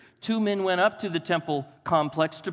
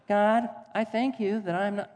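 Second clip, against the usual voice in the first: higher, different smug Pharisee; another Pharisee voice try; 6 semitones higher than usual. Retold or imitated. Pharisee thanking God he is not like others, higher and smug. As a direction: try a new pompous take. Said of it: "maybe that's not either".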